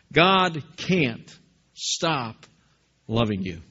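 an unhurried, tender reassurance; slow tender refrain. Real, not acted. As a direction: let each word land gently. Refrain of the sermon's key line.

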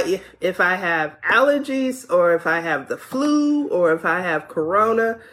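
ordinary delivery

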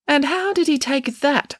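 high-pitched wary questioning; suspicious. A narrator's reading. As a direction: probe with growing distrust. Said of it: "asked Judith Piggins suspiciously".

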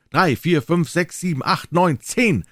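high, loud, measured count; bell counting. Acted. The great bell counting the hours, high and loud. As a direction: toll each number like a bell. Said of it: "sagte die Glocke".

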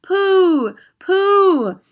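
very high, loud pooh; disgusted cry. Acted. Witch audience crying out in disgust, high. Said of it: "cried the audience".

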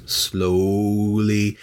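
very slow, suspenseful stretch of the word; drawn-out. A narrator's reading. Storyteller as Kate opens the coffin, drawn-out. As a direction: draw the word right out for suspense.

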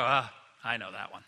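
low, dismissive know-it-all; bored listener. Retold or imitated. Congregant brushing off a familiar story, low and dismissive. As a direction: shrug it off as old news. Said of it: "You'll go".

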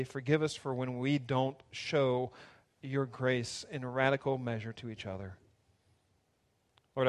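soft, hushed prayer. Acted. Pastor praying over the church, soft and earnest. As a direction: pray gently over the church.

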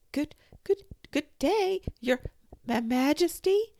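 high, loud, stammering greeting; frightened stammer. A narrator's reading. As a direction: stumble over the words in fear. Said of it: "the Duchess stammered".

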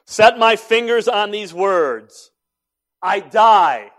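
loud, high-pitched proclamation of faith; emphatic declaration. Retold or imitated. Dying pastor to his youngest daughter, emphatic declaration. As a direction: cry it out with conviction.

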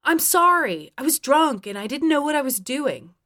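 apologize, pleading an excuse; frustrated, imploring excuse; pleading apology. A narrator's reading. Frustrated apology in an acted quarrel.

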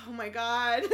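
dismay that cracks into a laugh. Retold or imitated. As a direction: crew realising, half laughing. Crew realises Lila's in danger.

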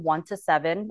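ordinary talk, nothing marked

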